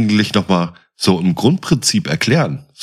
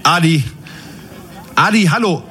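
higher, urgent calling into a handset; urgent radio calling; 7 semitones higher than usual. Acted. Moritz calling Adi over the walkie-talkie, urgent. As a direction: call for Adi, desperate for an answer.

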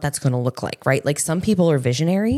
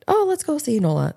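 imitating a child's eager voice, pitched higher; light playful kid voice, chirpy and high; excited kid; 10 semitones higher than usual. Son spots his friend outside.

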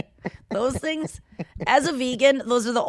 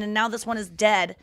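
ordinary talk

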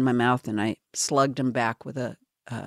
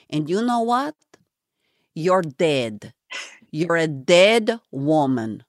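hammed-up imitation of a foreign-accented truth-teller, starting high; Ruth Pontvian, bad accent; 3 semitones higher than usual. Host imitating the guest who caught her taking pills, bad accent. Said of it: "I get to do my terrible Ruth Pontvian imitation".

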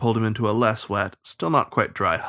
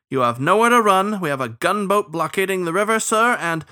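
high, louder officious warning; threatening starling official; 9 semitones higher, 5 dB louder than usual. Acted. Starling warning the captain of a blockade, officious threat. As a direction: press the threat with voice raised.

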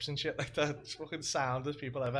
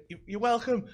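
retold excited reply; 9 semitones higher, 7 dB louder than usual. Host replies at party.

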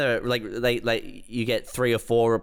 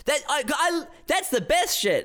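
delighted; 14 semitones higher than usual. Real, not acted. Praising his own comeback, gleeful. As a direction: savour your own brilliant idea.

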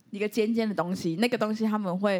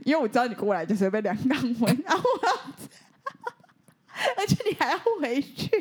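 laughing; 5 semitones higher than usual. Real, not acted. Laughs at her own joke.

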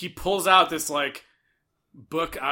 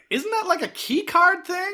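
high incredulous question; 12 semitones higher than usual. Retold or imitated. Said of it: "I was like".

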